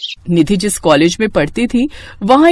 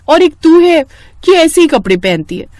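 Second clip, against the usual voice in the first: mocking jab, pitched up and louder; taunting; 8 semitones higher, 4 dB louder than usual. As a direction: needle her about her clothes. Sheetal taunting Nidhi.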